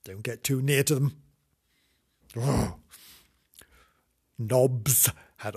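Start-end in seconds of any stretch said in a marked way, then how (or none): none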